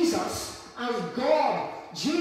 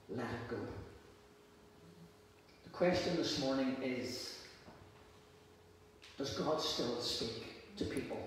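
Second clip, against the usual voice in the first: quiet sermon opening; 9 semitones lower, 7 dB quieter than usual. Acted. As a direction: draw the church in gently.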